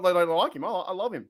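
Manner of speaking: usual speaking voice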